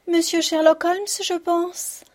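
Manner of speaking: voix aiguë de vieille domestique; vieille servante. A narrator's reading. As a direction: prends une voix haut perchée de servante âgée. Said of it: "une femme âgée se tenait sur les marches". (vieille servante à la voix haut perchée)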